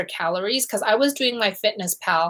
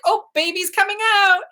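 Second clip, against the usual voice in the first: urgent; 12 semitones higher, 4 dB louder than usual. Retold or imitated. Alarmed mother in labor.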